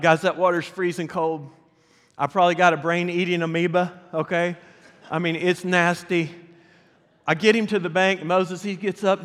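ordinary talk

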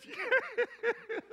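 very high, wailing Southern yes; mock-tearful Southern yes; sobbing southern girl. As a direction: squeak it out like a crying teen girl. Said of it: "She had a real southern accent".

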